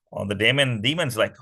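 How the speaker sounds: usual speaking voice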